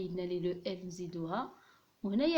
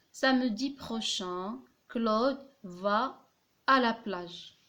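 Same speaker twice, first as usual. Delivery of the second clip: slow dictation; much slower than usual. Shown as a demonstration. Dictating the example sentence.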